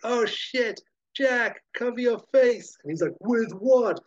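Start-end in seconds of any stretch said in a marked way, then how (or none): 0.1-2.8 panicked shout. Acted. Annie panics at helicopters. 3.2-4.0 alarmed shout. Acted. Jack retorts, acted quote.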